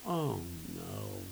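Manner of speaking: low, soft mock groan; dismayed aside. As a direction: sink into dread, drawn out and low. Acted inward groan of dismay.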